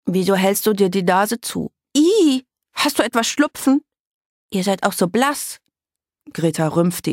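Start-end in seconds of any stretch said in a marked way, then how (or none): 0.1-5.6 nasal, higher-pitched disgusted questioning; congested girl voice with a squeal of disgust; stuffed-nose girl. A narrator's reading. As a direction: quiz them through a blocked nose. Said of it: "als wäre ihre Nase verstopft".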